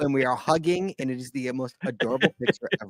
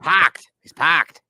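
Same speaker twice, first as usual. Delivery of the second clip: mock Australian accent, punched louder and higher; 3 semitones higher, 8 dB louder, much slower than usual. Host parroting his Australian co-host's word, mock Australian accent. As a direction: imitate an Aussie saying "parked". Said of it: "I figured out his accent".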